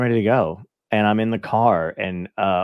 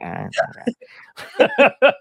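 a much higher, very slow laugh through the words; much slower than usual. Retold or imitated. Club owner laughing off giving up coffee. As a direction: chuckle while brushing off the worry. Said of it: "he's like".

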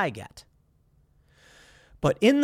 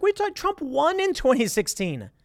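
incredulous; 8 semitones higher than usual. Retold or imitated. Retold incredulous reply.

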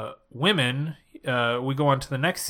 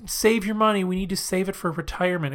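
put-on reassuring girlfriend; 6 semitones higher than usual. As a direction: play her gently talking him out of it, pitched up. Voicing woman soothing older man.

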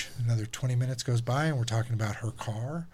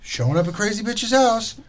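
a lightly voiced, higher, giddy mock Julie; imitating Julie; 8 semitones higher, 4 dB louder than usual. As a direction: play her as a batshit single woman, half-hearted. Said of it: "It's just Julie acting so, like, single, batshit".

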